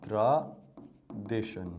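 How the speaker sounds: slowly drawn-out word. Shown as a demonstration. Teacher drawing out a word for students, very slow and low. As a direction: drag out "tradition" syllable by syllable, dropping low. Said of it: "Our tradition".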